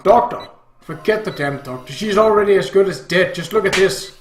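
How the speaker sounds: loud, scornful father; heated dad cutting her off; angry shouting. Acted. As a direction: explode in exasperation. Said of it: "Dad got irritated and started shouting".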